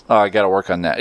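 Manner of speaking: ordinary delivery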